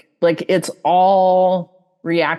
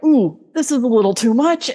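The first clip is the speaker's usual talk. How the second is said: higher, quick, lightly overwhelmed; mild acted unease; 5 semitones higher, faster than usual. Teacher voicing someone mobilized and excited, mildly uneasy. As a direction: voice a small flutter of alarm.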